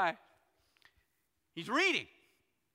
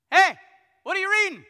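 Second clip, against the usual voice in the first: higher, louder call up to a passing rider; 10 semitones higher, 14 dB louder than usual. Retold or imitated. Philip calls up to the treasurer riding by, loud and high. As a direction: hail him from the roadside, loud and high.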